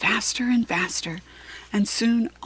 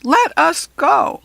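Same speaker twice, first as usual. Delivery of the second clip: goblin whine; 8 semitones higher, 8 dB louder, slower than usual. A narrator's reading. Goblin voice continues.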